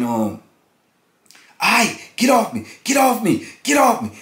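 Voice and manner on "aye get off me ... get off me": high mock yell, get off me; acting out a cry through the wall; imitating a man's frantic plea, higher pitched; retold yell. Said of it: "you heard them saying".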